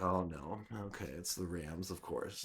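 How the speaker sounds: inner groan. Retold or imitated. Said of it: "in my mind".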